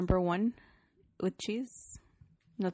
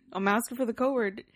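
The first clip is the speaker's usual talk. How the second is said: normal talking voice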